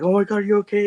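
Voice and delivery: high-pitched mock panic; imitated freak-out. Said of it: "he's all freaking out".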